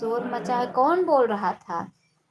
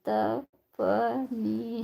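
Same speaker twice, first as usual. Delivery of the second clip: usual speaking voice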